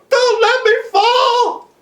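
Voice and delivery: high, loud, drawn-out wail; terrified pleading. Acted. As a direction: beg in panic, stretching each word. Terrified boy begs his rescuer.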